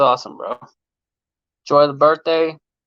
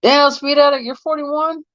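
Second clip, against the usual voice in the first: real surprise; 12 semitones higher than usual. Real, not acted. Streamer shocked that Speed Addict is forty-one, high disbelief. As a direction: pitch jumps much higher, astonished. Learns viewer's age, astonished.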